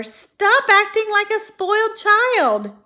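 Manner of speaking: very high, loud, slow scream. Acted. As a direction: scream in frustration. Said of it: "screamed Wilbur".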